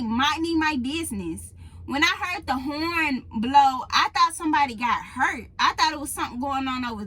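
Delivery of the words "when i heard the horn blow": usual speaking voice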